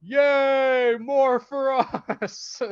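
mock sarcastic cheer. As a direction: drag it out gleefully. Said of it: "Chris Rock's like".

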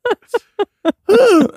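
high burst of laughter; loud laugh. Real, not acted. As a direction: really crack up. Big laugh at own story.

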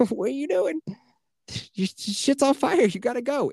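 high, mock-frantic urging; mock urgent yell. Mocking people stuck on the stairs with a frantic yell.

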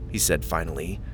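plain reading voice

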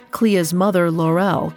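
usual speaking voice

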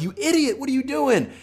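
high-pitched imitation of a yelling fan; exasperated fan screeching at a player; mock fan yell. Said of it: "you're yelling at him, you're screaming at him".

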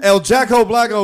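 El Jacko Blacko with hammed Spanish endings; mock Spanish name. Shown as a demonstration. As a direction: Hispanicize the name playfully. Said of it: "not gonna say that properly".

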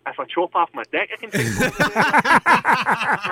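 mock reply. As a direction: play it up, high and drawn out. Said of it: "I was like".

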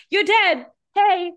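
loud, high, tactless announcement; mock-brusque call to get someone's attention; blunt impatience. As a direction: blurt it out high and loud, no cushioning. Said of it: "She has not gotten any better".

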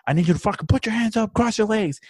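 high, fast mock bark of orders; imitated cop shouting. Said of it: "telling him, like".

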